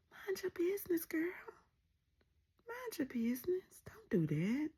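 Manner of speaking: whispered, mock secret warning; hushed warning. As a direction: whisper it like a friend pulling her aside. Warning Kelly to stay out.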